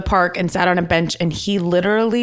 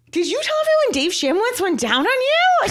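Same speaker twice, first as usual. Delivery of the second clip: shocked friend. Retold or imitated. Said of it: "She goes".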